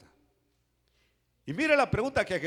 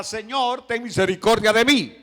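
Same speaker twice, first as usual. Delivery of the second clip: loud, high plea for mercy; crying out; 4 semitones higher, 11 dB louder than usual. Acted. Bartimeo begging Jesus for mercy, crying out. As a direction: cry it out to be heard over a crowd. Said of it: "Bartimeo gritaba".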